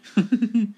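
amused high laugh. Real, not acted. Laughs at the translator's voice.

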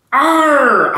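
high, loud roaring arr. Acted. Narrator roaring a loud high arr at the pirates. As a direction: roar it back at the pirates. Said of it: "I roared at them".

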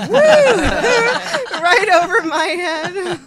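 high, loud laughing through words; laughing hard. Real, not acted. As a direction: crack up mid-sentence. Finally gets the joke.

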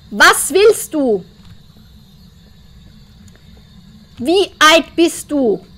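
slow, deliberate questions; slow ghost questioning. Acted. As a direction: ask each word slowly into the dark.

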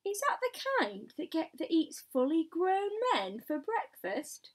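high-pitched, anxious asking; frightened question. A narrator's reading. Fire chief asking about man-eating creature, frightened. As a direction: ask it dreading the answer.

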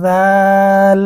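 জাল with a soft th-like z and long a; soft articulation. Shown as a demonstration. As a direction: soften the first sound of জাল, then hold the vowel loudly. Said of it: "একটু নরম করে উচ্চারণ". (নরম করে জাল টেনে)

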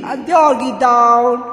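ordinary delivery

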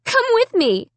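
very high, urgent cry; cried out. A narrator's reading. Girl calling family to follow her, crying out. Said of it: "I cried".

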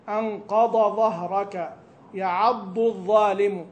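"أنقض ظهرك" slow, "يعض" with ض and "الظالم" with ظ; clear dad and zha. Shown as a demonstration. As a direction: draw out each ض and ظ distinctly. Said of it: "هذه بالضاد والظالم بالظاء". (نطق بطيء يميز الضاد من الظاء)

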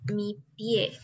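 usual speaking voice

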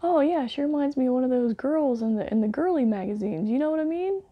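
high, loud leering comic voice; sleazy comic. Retold or imitated. Mocking the creep who loves girly magazines, high leering voice. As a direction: lay the creepy comic on thick.

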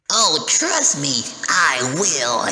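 slow, loud and threatening promise; menacing loud vow. Acted. Demon to the guardian, promising revenge in a loud menacing voice. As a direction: growl it out like a vengeful demon. Demon vows to handle Salazar.

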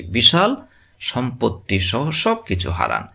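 usual speaking voice

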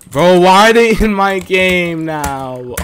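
loud, high, slow drawn-out complaint; exasperated wail. Real, not acted. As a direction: wail in despair at the spammers. Spammers follow into game.

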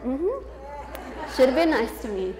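smug, rising mm-hmm, then a playful jab; a pointed little gloat at the bullies; sassy little comeback. Retold or imitated. Kid with snacks gloating to bullies who must watch, sassy. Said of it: "that was my little".

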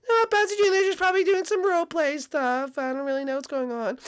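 mom voice. Acted. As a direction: speak as a gentle mom to her son. Mom voice, confused.

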